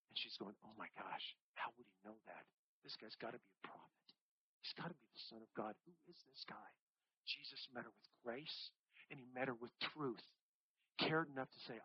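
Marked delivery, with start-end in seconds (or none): 0.6-6.7 very soft, awed wondering; the woman at the well, awed, in a hush; hushed amazement. Retold or imitated. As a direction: breathe the questions in quiet awe. Woman amazed, voiced very softly.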